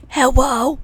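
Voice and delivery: loud, sudden mimicked phone alert; notification sound imitation. Player mimicking dad's new phone alert, loud imitation. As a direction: blurt it like a startling notification. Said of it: "his notification sound now is".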